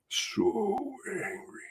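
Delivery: so angry, breaking into a whispered sob; tearful whisper. Real, not acted. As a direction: choke the words out slowly. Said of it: "I got so angry".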